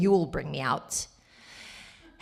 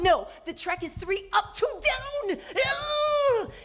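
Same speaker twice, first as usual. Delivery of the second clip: much higher, shouting on the edge of tears; crying shout; slower than usual. Retold or imitated. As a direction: wail it in desperate frustration. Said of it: "And I was like".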